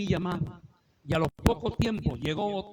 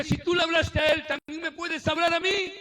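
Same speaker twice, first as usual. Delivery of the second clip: higher, louder eager plea to God; pleading cry; 9 semitones higher, 5 dB louder than usual. Retold or imitated. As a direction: reach up and ask, urgent and hopeful. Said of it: "Yo dije". (súplica ansiosa, más alta y fuerte)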